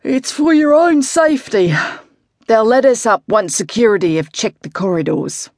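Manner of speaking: lightly voiced, breezy older coach; offhand coach. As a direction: brush the worry aside. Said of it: "Tom yawned and waved the question away".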